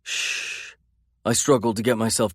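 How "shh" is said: whisper. A narrator's reading. Calming a sobbing woman.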